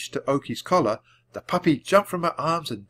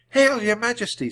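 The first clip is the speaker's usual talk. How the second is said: Annie greeting; 11 semitones higher, 3 dB louder than usual. A narrator's reading. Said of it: "said Annie".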